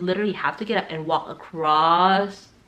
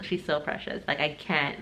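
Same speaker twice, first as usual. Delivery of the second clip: usual speaking voice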